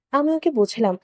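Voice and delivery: ordinary delivery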